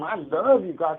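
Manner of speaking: high, loud mock gushing; gushing gratitude. Patient thanking doctors after morphine, gushing gratitude. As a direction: gush it out, high and drawn out.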